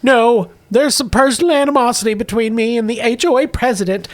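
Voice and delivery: high-pitched housewife with a grudge; Gamorrean woman. Acted. Woman telling visitors of her feud, high-pitched. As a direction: explain the feud in a high character voice. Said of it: "A middle-aged Gamorrean woman".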